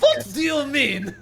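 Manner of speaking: high, loud and slow through laughter; cracking up while asking; real laughter breaking the question; laughing disbelief. Real, not acted. Mock outrage over accent jokes.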